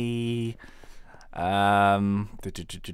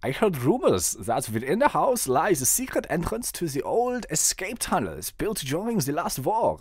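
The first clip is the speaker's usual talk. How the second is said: put-on German accent; 6 semitones higher than usual. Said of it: "Maybe you do German".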